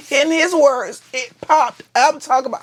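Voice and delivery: usual speaking voice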